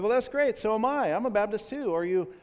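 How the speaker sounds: high-pitched, eager acted voice; hammed-up enthusiasm; delighted rescuer. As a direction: gush at finding common ground. Rescuer's growing delight in joke.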